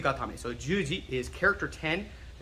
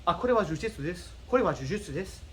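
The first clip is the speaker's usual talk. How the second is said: rapid, higher-pitched Japanese, syllables run together; quick Japanese; 4 semitones higher, faster than usual. Acted. Teacher acting Japanese speakers naming the art, quick blurred speech. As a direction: rattle it off quickly like a native speaker. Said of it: "they say it kinda quickly".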